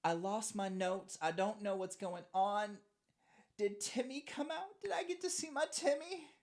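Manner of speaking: high-pitched bewildered voice, rising as it goes; climbing, high voice of confused longing; dazed, lost character. Acted. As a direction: play someone lost and pleading after Timmy. GM voices foam-covered Kelsey.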